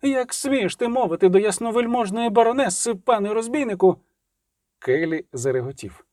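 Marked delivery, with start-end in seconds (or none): 0.0-4.0 high, indignant matron; outraged noblewoman, pitched high; brittle cry. A narrator's reading. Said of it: "крикнула ламким голосом дама".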